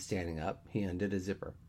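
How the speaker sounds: plain reading voice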